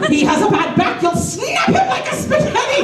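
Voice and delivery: put-on high-pitched Irish old lady voice; old Irish woman. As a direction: warn him in a high, elderly Irish accent. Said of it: "this old Irish woman".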